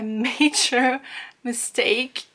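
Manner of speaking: ordinary talk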